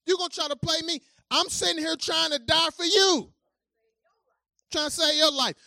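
loud, high indignant retort; petty complaint. Pretend. Preacher imagining a petty Jesus answering mockers, mock indignation. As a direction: huff at them in mock outrage. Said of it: "I would have been like".

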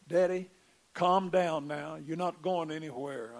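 gruff son. Retold or imitated. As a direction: tell your father to settle down, with a heavy voice. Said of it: "he's kinda gruff".

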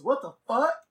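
high, rising incredulous question; retold disbelief. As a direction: throw it out in mock disbelief. Retelling his shocked last words.

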